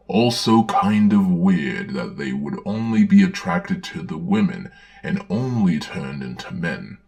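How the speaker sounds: normal talking voice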